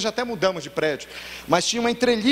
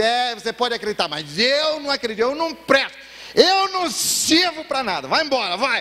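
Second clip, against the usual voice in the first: put-on drunk man's despair; 7 semitones higher than usual. A drunk Marcelo tells his pastor he is worthless, in despair. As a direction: plead your own worthlessness, dragging every word. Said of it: "Não, Marcelo, eu te amo".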